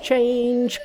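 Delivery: high, ringing "Change!"; magic command cried out. Acted. Monkey working magic on a hair, crying out. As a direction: call the spell out sharply. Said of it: "cried out".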